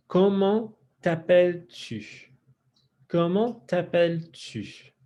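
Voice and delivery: teacher's slowed-down French question; slow pronunciation model. Shown as a demonstration. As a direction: break comment t'appelles-tu apart for learners. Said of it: "let's do some pronunciation work".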